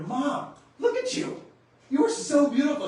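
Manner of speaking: high, loud, gushing praise; adoring praise. Retold or imitated. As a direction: gush over her like a delighted son. Son praising mother in story.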